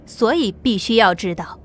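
plain reading voice

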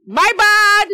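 ordinary talk

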